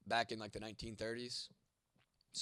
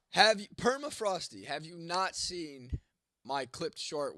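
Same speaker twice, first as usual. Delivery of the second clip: exasperated; 5 semitones higher, 8 dB louder, slower than usual. Real, not acted. Exasperated at chat question.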